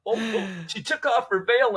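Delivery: high, laughing retelling voice; amused storyteller voice. Retold or imitated. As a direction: tell it like a friend cracking up. Voicing the amused language assistant.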